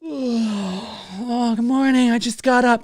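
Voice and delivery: hammed-up pretend just-woke-up greeting; fake morning vlogger. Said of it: "they wake up in the morning and they're like".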